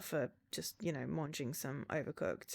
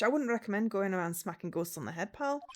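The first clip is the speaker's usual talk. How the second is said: a Scottish accent for the detective; 2 semitones higher, 6 dB louder than usual. Shown as a demonstration. Reading the detective's line in a Scottish accent. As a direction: give the line a Scottish accent, pal and all. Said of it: "I wanna go freaking Scottish".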